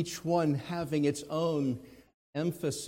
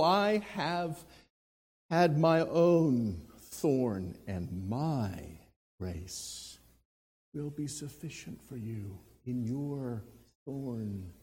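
tender Jesus voice; 5 semitones lower, slower than usual. Acted. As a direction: comfort him gently. Voicing Jesus comforting Paul.

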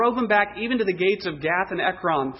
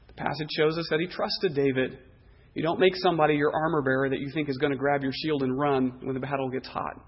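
usual speaking voice

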